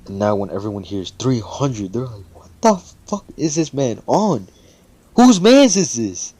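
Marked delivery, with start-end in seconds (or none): 2.3-6.3 very high, loud mock disbelief; hammed-up crowd outrage, stretched at the end; incredulous outcry. Classmates hearing the three hundred score, loudly incredulous.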